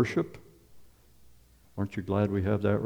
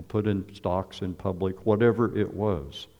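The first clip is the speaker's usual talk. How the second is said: ordinary talk, nothing marked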